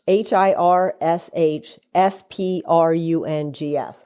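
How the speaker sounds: slow letters H I R S H S P R U N G S spelled one by one; spelling it out. Shown as a demonstration. Mom spells the name again for her son, slow letters.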